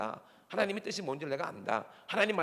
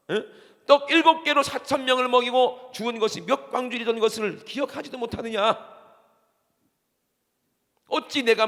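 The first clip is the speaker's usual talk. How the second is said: preaching Jesus's rebuke; 5 semitones higher, 10 dB louder than usual. Acted. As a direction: thunder the scripture at the congregation. Preaching scripture quote.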